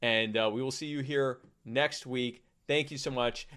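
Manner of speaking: ordinary talk, nothing marked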